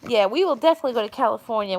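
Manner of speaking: usual speaking voice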